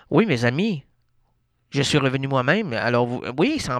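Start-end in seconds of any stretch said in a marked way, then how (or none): none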